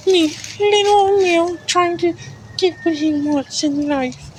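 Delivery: very high, drawn-out squeaky cartoon voice. Shown as a demonstration. Showing off the squeaky voice he could talk with, squeaky. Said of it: "I could talk with a squeaky voice".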